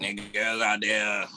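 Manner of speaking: slow vocoder-like sermon; slow mock robotic preaching; robot-voiced pastor. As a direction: drone it out like a voice-box preacher. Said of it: "he sounded like".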